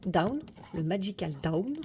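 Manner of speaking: DOWN with a careful English "ow" diphthong; over-careful English accent on DOWN, said "da-oun"; effortful English accent. Shown as a demonstration. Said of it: "avec mon superbe accent anglais". (accent anglais appliqué sur DOWN)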